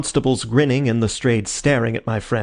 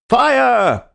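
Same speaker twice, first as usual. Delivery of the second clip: loud, high shout of alarm; sudden high alarm call; group yell; 12 semitones higher, 6 dB louder than usual. A narrator's reading. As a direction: cry out fire at full voice. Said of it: "we all yelled".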